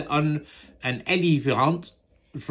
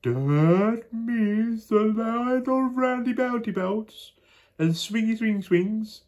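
slow put-on voice; 11 semitones higher, slower than usual. Child voicing Moonface.

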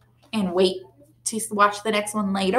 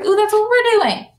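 excited; 11 semitones higher, 5 dB louder than usual. A narrator's reading. Excited child voice.

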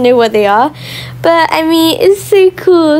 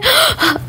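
quick high gasp of amazement; short soft surprised gasp; sharp gasp; 10 semitones higher, 5 dB quieter than usual. Real, not acted. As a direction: gasp at what you see. Amazed at the decor.

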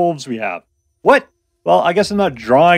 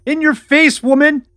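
high, playful taunt; mock gloating; 10 semitones higher, 3 dB louder than usual. Husband taunting his wife over an argument, mock gloating. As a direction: crow over a won argument.

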